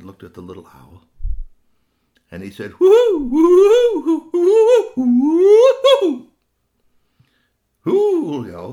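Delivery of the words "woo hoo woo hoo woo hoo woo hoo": very high, loud, long hoots; owl hoots. Acted. Human speaks owl to the owlet, high loud hooting. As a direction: call like an owl, woo-hoo. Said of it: "he's speaking owl to me".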